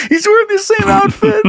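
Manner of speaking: high, giggling through words; laughing while telling it. Real, not acted. Laughing at the thief story.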